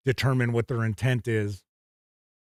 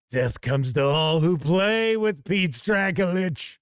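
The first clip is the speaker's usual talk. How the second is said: slow, drawn-out, higher mock pronouncement; mock doom proclamation; 4 semitones higher, slower than usual. Game master teasing a very serious chat member, mock doom proclamation. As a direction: proclaim it slowly like a hammed-up prophecy of doom.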